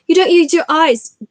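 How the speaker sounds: high, loud, sharp scolding; snapped. Acted. Hermione scolding the boys in the common room, sharp snap. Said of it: "she snapped".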